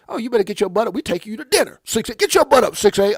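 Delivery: loud, high imitation of an excited sister; bossy excited sister. Acting out sister ordering him to dinner.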